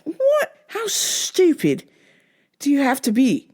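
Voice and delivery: high, slow, stretched-out disbelief; stunned disbelief. Retold or imitated. Retelling her reaction to the customer, high slow disbelief. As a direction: drag out how stupid, pitched high. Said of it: "I was just like".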